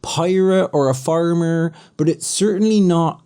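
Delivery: rustic West Country pirate voice; West Country accent. Shown as a demonstration. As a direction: roll it out like a West Country farmer. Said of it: "the traditional West Country accent kinda sounds like this".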